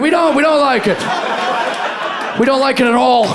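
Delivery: hammed-up dismay; horrified crowd. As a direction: answer back like a shocked crowd. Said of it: "We're like".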